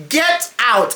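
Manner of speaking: an incredulous "Get out." at his nerve; exclaimed get out. Real, not acted. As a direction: throw out "Get out." in disbelief. Louder repeat of the dismissal.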